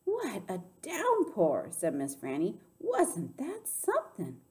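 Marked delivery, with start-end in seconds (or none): 0.0-1.7 high, drawn-out exclamation; amazed storybook lady; exclaiming. A narrator's reading. Miss Franny exclaims after running inside. 2.8-4.4 high, slow, swooping exclamation; amazed storybook lady; marvelling. A narrator's reading. Miss Franny marvels at the rain.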